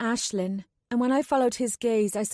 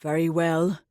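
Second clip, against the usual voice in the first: gentle Anulan; 5 semitones lower than usual. A narrator's reading. Anulan asks the child for help, low and gentle. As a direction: reassure the little one.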